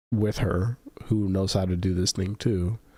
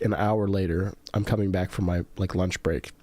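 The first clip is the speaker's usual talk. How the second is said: ordinary talk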